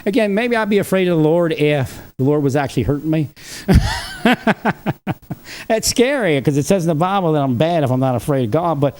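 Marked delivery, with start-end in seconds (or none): 3.5-5.6 long high-pitched laugh in quick bursts; real rattling belly laugh, pitched high; hearty laugh. Real, not acted. Tickled by his own excommunication threat, hearty laugh.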